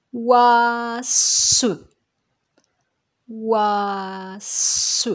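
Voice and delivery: "Ouasse" with a long held vowel, then a hissed s; "Ouasse" slowly sounded out as ouaaa-sssse; stretched syllable. Shown as a demonstration. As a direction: draw out "Ouasse", sounding a final schwa.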